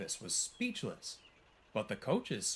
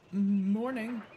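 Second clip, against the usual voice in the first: high, louder, hesitant greeting; nervous stutter; 10 semitones higher, 7 dB louder than usual. A narrator's reading. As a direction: stutter a timid hello to the coaches. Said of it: "she stuttered as she coupled".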